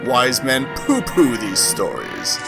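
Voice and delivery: ordinary delivery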